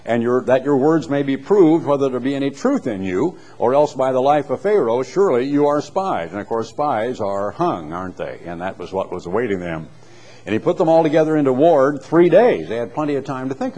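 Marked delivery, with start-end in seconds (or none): 0.0-6.3 raised, louder Bible-reading voice; lifted public reading of scripture. Acted. Reads scripture aloud. 10.4-12.7 raised, louder Bible-reading voice; lifted reading of scripture. Acted. Reads scripture aloud.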